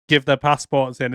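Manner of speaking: ordinary talk, nothing marked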